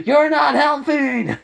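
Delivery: high, loud, slow yell. Acted. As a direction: shout it high across the distance in exasperation. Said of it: "And then Naruto yells".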